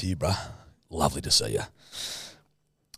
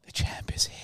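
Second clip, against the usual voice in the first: creepy whisper; imitating a creepy whisper; 6 dB quieter than usual. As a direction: hush it like a creep. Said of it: "whispering in his ear like a creep".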